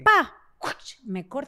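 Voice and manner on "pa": sudden high, loud "pa"; imitated cutting noise. Storyteller mimicking the thief's knife slash, sudden high noise. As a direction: punch out a slashing sound effect.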